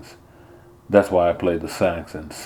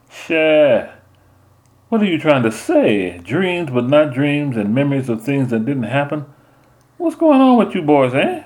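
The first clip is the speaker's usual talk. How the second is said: higher, louder mama, baffled; a mother pressing her son, rising in disbelief; puzzled mother; 8 semitones higher, 3 dB louder than usual. Acted. Mama questioning her son about strange dreams, baffled.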